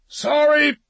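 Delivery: high, loud shouted apology; calling out. A narrator's reading. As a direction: shout the apology across a distance. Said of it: "he called out".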